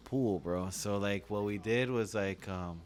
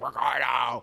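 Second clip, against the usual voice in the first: raised, loud mock yell for a brother; retold scream; 3 semitones higher, 6 dB louder, slower than usual. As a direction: imitate a cousin yelling for his brother. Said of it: "he would start screaming his brother's name".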